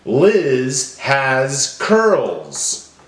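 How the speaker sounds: very slow, each word drawn out. Shown as a demonstration. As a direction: stretch each word to its final sound. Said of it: "they all end with the same sound".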